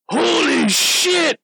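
high, drawn-out holler; imitated drunk shout. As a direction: yell it like a drunk heckler. Imitating drunk men yelling at friend.

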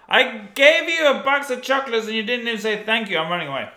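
high, loud whiny complaint; whiny nice guy. Retold or imitated. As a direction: sulk like a spurned nice guy. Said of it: "He just wants to kinda go".

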